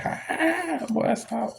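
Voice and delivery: high, giggly imitation of a laughing "boy, stop"; mock laughing brush-off. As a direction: imitate his laugh as he waves it off. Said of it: "that smile and that laugh".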